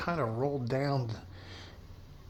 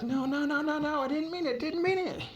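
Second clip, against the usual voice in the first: very high, frantic repeated no; terrified begging. Acted. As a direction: panic as the car slides. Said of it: "Maria's like".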